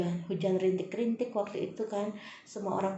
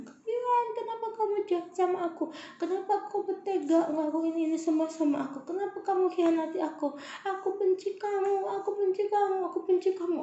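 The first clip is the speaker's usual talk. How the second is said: retold scream at husband; 10 semitones higher than usual. Wife screaming at her cheating husband Kian, high anguished cry. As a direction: scream it at the sea, heartbroken. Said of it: "di pantai itu aku teriak".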